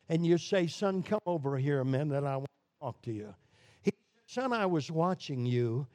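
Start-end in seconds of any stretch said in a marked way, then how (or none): 0.7-3.3 soft, calm call from a kind dad; gentle father. Acted. Father calling son gently. 4.3-5.9 softer, patient fatherly tone; gentle father. Acted. Father gently correcting son.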